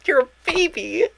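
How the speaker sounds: put-on high cooing baby talk voice. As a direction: coo like at a baby. Cooing at a cute character.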